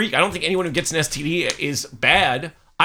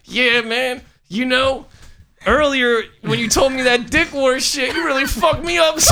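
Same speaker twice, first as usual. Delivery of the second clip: talking through clenched teeth, higher; through gritted teeth; 9 semitones higher than usual. Retold or imitated. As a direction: jaw clamped, mouth shut, pitched up. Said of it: "And I'm talking through my teeth".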